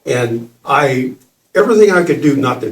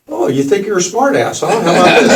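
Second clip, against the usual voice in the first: high, laughing, mocking taunt; teasing god Shiva; 6 semitones higher, faster than usual. Shiva in the child taunting him, high mocking laugh. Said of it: "appeared in this child's body and just went".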